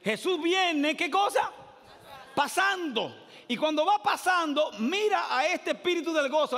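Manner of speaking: high, loud rising sermon build; fiery preaching. Acted. Pastor tells the Zacchaeus story to the church, fiery.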